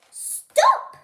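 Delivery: sudden loud high shout of the sign's word; shouted stop. Acted. Reader voicing the sign as a loud protest shout. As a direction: yell it like a protest banner.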